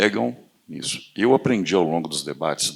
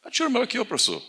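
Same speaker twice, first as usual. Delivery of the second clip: amazed quote; faster than usual. Retold or imitated. Quoting instructor's amazement.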